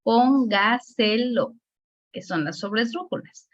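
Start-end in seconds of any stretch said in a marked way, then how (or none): 0.0-1.6 "póngaselo" slowly split as pón, ga, se, lo; teacher's slow, segmented "póngaselo"; syllable-by-syllable demonstration. Shown as a demonstration. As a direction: sound out each syllable of "póngaselo" with a strong PÓN. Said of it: "palabras que tienen cuatro antes de la antepenúltima".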